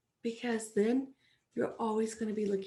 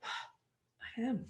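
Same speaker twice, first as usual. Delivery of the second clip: sighing defeat; 10 dB quieter than usual. Retold or imitated. Sighing self-judgment.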